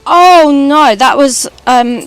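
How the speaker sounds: usual speaking voice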